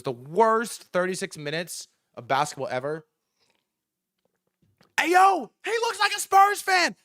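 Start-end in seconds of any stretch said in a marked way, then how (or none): 5.0-7.0 loud, high-pitched call-out; mock street yell. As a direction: alert the whole street to a rival. Imitating fans spotting a rival.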